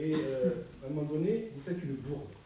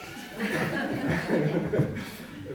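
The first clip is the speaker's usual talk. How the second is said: short real laugh after a remark; 3 semitones higher, 4 dB louder than usual. Real, not acted. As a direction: laugh it off for a couple of seconds. Rit après sa remarque sur Patrice. (rire spontané)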